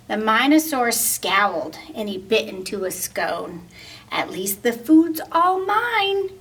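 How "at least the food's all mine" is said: grumbled. Acted. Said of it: "he grumbled".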